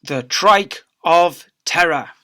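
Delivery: plain reading voice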